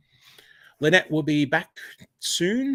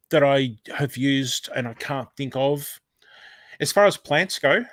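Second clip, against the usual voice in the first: normal talking voice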